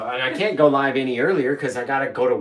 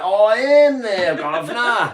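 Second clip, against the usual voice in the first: high, slow, loud mock British; terrible British accent; 7 semitones higher, 5 dB louder, much slower than usual. Co-host mimicking the British driver's reply, bad British accent. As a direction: lay on a cartoonish British accent. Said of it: "we're sorry for the terrible accent".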